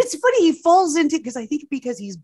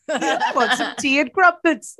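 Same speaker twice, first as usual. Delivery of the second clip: put-on higher British accent, breaking with laughter; 4 semitones higher than usual. Co-host echoes the host's line, laughing British accent. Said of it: "pretty good at your accents".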